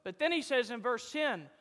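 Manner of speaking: usual speaking voice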